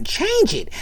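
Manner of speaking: put-on high kid's voice, pushy; whiny little sister wanting the TV; demanding kid.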